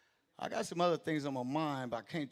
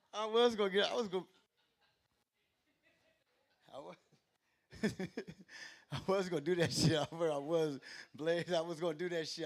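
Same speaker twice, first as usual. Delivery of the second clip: laughing; 3 semitones higher, 3 dB louder than usual. Real, not acted. Laughing through excuse.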